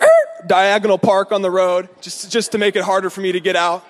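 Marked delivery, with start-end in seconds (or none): 0.0-0.5 short high-pitched screech of brakes; tire screech. Retold or imitated. Pursuer's car swerving to block the road, imitated screech. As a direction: imitate a car skidding to a stop. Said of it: "and he's like".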